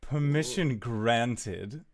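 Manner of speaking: slow, drawn-out granting of leave; unsettling captain. Acted. Captain allowing strangers aboard, slow and unsettling. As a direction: savour each word ominously. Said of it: "I don't like the way you said that".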